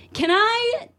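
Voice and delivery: high, drawn-out words with long pauses; imitating someone who pauses too much; halting impression. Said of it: "he pauses too much".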